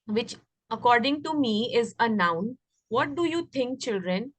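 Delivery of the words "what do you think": ordinary delivery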